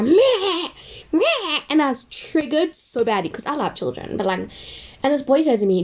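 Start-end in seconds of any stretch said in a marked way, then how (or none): 0.0-1.6 high mock newborn wail with a laugh; imitated baby cry over speakers. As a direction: mimic a crying baby on the speakers. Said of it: "so you just hear".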